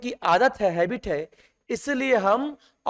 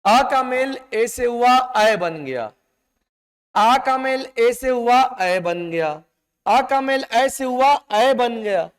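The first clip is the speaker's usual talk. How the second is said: slow repeated rule; 2 semitones higher, 4 dB louder, slower than usual. Shown as a demonstration. Teacher repeats the vowel rule for students, slow and emphatic. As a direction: rule chanted slowly, each repeat louder. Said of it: "clear है?".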